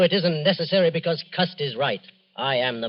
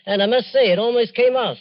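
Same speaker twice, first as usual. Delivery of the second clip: higher and louder, proud of the plan; villain crowing to the police, higher and louder; gloating boast; 9 semitones higher, 6 dB louder than usual. Acted. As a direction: crow about it, pleased with himself. Murderer boasting of near success.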